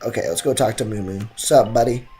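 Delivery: usual speaking voice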